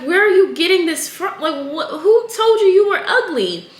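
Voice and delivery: high, astonished mother's voice with a laugh; shocked mom. Retold or imitated. Retold mother asking her daughter who said that, high and shocked. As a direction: pitch way up like a stunned parent. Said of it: "And her mom's like".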